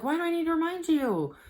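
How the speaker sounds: exasperated. Retold or imitated. Thinking to myself, exasperated at husband.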